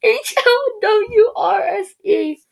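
slow, high, spelling it out while crying with laughter; laugh-crying the letters. Real, not acted. Spelling out a misspelled ad, laughing so hard it sounds like crying. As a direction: wheeze the letters through tears of laughter.

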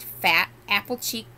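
usual speaking voice

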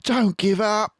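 high fox voice, urging and breathless; Mister Fox panting. A narrator's reading. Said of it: "panted Mister Fox".

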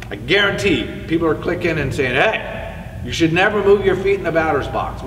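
ordinary talk, nothing marked

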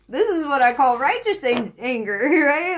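loud laughing fury, mostly giggles; laughing mad. Real, not acted. Tarot reader cracking up while declaring her anger. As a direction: laugh through your outrage, half serious. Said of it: "I'm mad".

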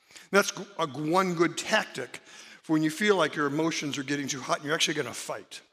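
normal talking voice

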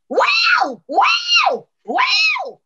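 very high, loud panther yowl; imitated panther scream. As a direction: screech like a wild cat close by.

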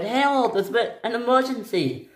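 high-pitched urgent call. Acted. Stephen reporting to Harold, urgent high call. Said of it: "he shouted".

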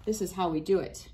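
ordinary talk, nothing marked

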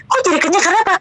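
retold surprise, pitch lifted; taken aback. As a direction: recreate your bewildered reaction. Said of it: "saya kaget dong".

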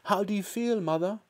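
high, tentative question; anxious. A narrator's reading. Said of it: "asked Missus Cranky anxiously".

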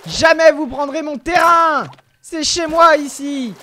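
loud, slow, high battle cry; defiant shout. Real, not acted. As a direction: defend your ground, yelling. Defending territory in game. (cri de défi)

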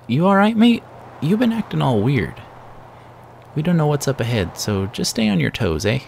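concerned companion voice. Acted. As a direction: call out to a friend acting strange. Companion voices worry to Surge.

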